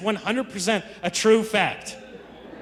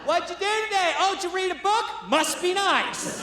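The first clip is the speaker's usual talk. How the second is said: high, sing-song mock of his wife, slowing on must be nice; 10 semitones higher, 3 dB quieter than usual. Comedian mimics his wife needling him in prison: high mocking sneer. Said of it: "she'd be like".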